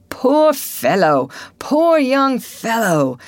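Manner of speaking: high, slow pitying cry; pitying townsman. A narrator's reading. Townsman pitying the murdered man, slow and high. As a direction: lament the dead man aloud.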